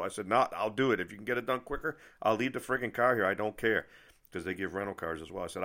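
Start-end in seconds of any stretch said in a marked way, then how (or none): 0.3-3.9 louder and higher, exasperated; frustrated insisting. Retold or imitated. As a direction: push back hard, fed up with the delays. Retelling frustrated plea to mechanic.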